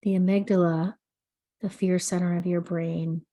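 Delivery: soothing sleep meditation read; guided meditation. Acted. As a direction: guide the listener gently into calm, unhurried. Leads an anxiety meditation.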